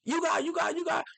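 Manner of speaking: high, excited, repeating the names; hyped up, shouting the praise; high-pitched thrilled fan; excited hype. Real, not acted. Hyped about the Jets' picks.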